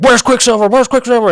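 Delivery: very high, very loud demand; yelled interrogation. Retold or imitated. Goblin beats Mateo for information, frantic high yelling. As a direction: shout it as if beating out an answer.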